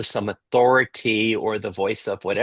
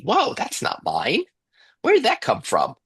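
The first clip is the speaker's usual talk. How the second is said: high-pitched astonished discovery; surprised realization; 10 semitones higher than usual. Acted. Coach voicing someone discovering a burden isn't theirs, surprised. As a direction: act out a sudden, puzzled realization.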